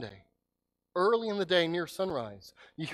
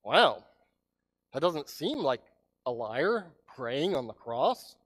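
ordinary talk, nothing marked

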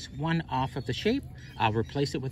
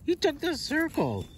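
excited high exclaim; 13 semitones higher than usual. Real, not acted. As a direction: voice jumps up excited. Excited about chipmunk.

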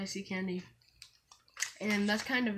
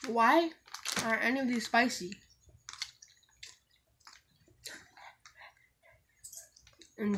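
talking while chewing; chewing candy. Real, not acted. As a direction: mouth partly full. Seen 1: Candy put in mouth at -3; jaw still working while talking.

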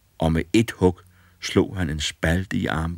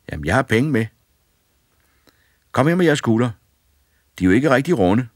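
put-on boy's voice, higher and louder; cheeky boy; 7 semitones higher, 7 dB louder, faster than usual. A narrator's reading. Fearless boy bossing the ghosts, cheeky and loud. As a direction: bold lad ordering the ghosts about.

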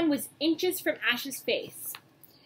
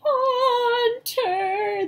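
high, loud wailing "Haunter!"; mock sobbing ghost wail; sobbing creature cry; 12 semitones higher, 7 dB louder than usual. A narrator's reading. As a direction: sob out the name like a crying ghost. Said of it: "the ghost Pokemon sobbed".

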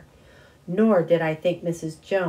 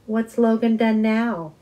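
joking; 6 semitones higher, 6 dB louder than usual. A narrator's reading. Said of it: "He spoke in a joking way".

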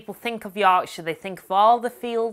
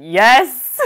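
imitating friend; 4 semitones higher, 9 dB louder than usual. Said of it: "is probably thinking".

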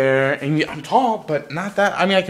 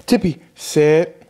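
dog command; 4 dB louder, slower than usual. Real, not acted.

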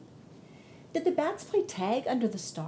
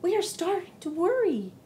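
worried little sister; 9 semitones higher than usual. Acted. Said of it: "exclaimed Daisy".

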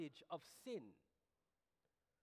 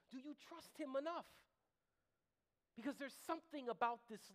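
higher-pitched, urgent sermon questioning; impassioned preaching; 7 semitones higher, 4 dB louder than usual. Real, not acted. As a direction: press the congregation to answer in their hearts. Presses the congregation with questions.

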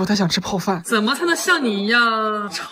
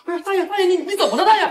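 alarmed; 10 semitones higher, 3 dB quieter, faster than usual. Real, not acted. Old man collapses, calling out.

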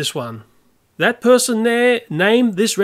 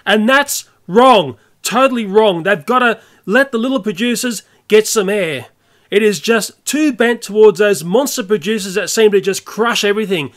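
real rant with the voice raised, leaning hard on key words; 2 semitones higher than usual. Real, not acted. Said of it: "sorry for ranting and raising my voice".

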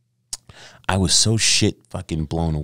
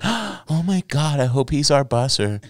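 put-on swooning girls; 10 semitones higher than usual. Girls gasping over handsome busser.

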